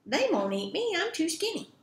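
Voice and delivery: high little goat voice, eager and loud at first; high-pitched little kid goat; littlest billy goat. Acted. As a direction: pipe up as the smallest goat. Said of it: "So the littlest billy goat said".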